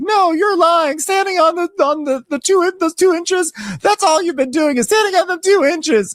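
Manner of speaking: very high, loud falsetto accusation; shrill hammed-up jab; mock Kelly. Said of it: "Kelly's like".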